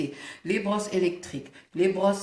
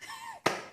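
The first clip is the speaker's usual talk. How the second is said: short high squealing giggle; high squeal laugh; 7 dB quieter than usual. Real, not acted. Laughs after the joke.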